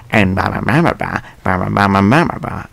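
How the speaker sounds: a loose, half-hearted mimic of a man who mumbles; mumbling customer. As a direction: babble nonsense syllables the way a mumbler slurs, lightly done. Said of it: "I can't really do a good impersonation".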